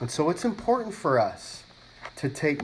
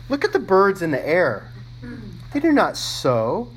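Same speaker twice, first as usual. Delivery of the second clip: loud, raised scripture read; 4 semitones higher, 4 dB louder than usual. Acted. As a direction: proclaim the verse to the congregation.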